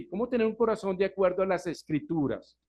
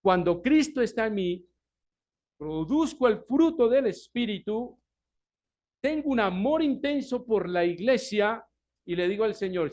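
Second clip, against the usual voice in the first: loud, higher preaching; fervent preaching; 5 semitones higher, 5 dB louder than usual. Acted. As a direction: proclaim it with full force. Predicación con fuerza. (predicación ferviente y en voz alta)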